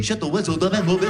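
babbled possessed gibberish; speaking in tongues. Acted. Girlfriend sits up in bed, babbling in tongues. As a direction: imitate a possessed sleeper babbling nonsense. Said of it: "She started speaking in tongues".

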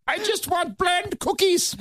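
high, slow mock whine; silly high voice. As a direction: whine it in a goofy falsetto. Mimicked finish sound.

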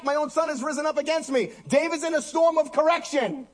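high-pitched, urgent pulpit delivery; impassioned preaching. Real, not acted. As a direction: read the psalm as a rising plea to God. Impassioned psalm reading and preaching.

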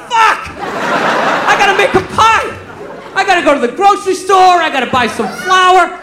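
suddenly remember the pie you promised; panicked realization. Retold or imitated. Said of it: "And I was like".

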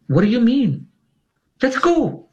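high-pitched incredulous urging; urgent disbelief. Retold or imitated. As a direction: retell a companion's urgent protest, pitch jumping up. Companion urging him to move.

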